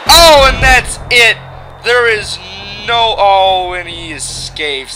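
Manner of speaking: shouting excited play-by-play; wrestling commentator. Acted. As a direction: yell the big finish. Calls the match play-by-play.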